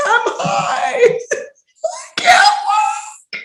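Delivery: very high, squealing fake cry; hammed-up stoned meltdown; mock wailing. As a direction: wail it helplessly, stretching the last words. Retelling being high and panicking.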